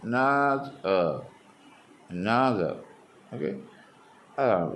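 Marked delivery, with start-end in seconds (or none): 0.1-1.0 "another" in three pieces, middle one high and drawn out; a small "a", a big held "noth", a detached "er" in "another"; second syllable stressed, split. Shown as a demonstration. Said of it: "രണ്ടാം syllable stress". 2.1-2.7 "another" joined up, middle syllable strong, then falling; stress on second syllable. Shown as a demonstration. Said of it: "രണ്ടാം syllable stress".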